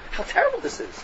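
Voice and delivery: high, hushed mock dismay; mock horror. Acting out shock at Rambam's language.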